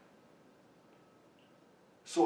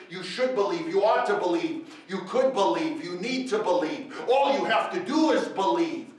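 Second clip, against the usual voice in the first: louder, insistent listing of commands; imitating a demanding preacher, louder each time; mock demands; 5 semitones higher, 10 dB louder than usual. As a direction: pile up each obligation, pushing harder.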